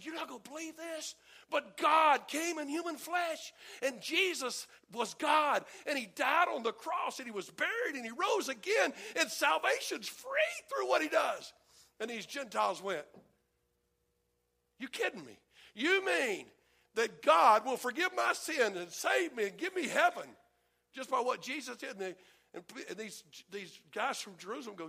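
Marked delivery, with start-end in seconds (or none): 0.0-11.5 high, loud, near-shouting news; bursting to share the best news ever; excited telling. Retold or imitated. Jerusalem believer telling a Gentile the gospel, high and excited. 14.8-21.9 raised, louder astonished questions; can't believe what he's hearing; amazed disbelief. Retold or imitated. Gentile hearing about free salvation, amazed and raised.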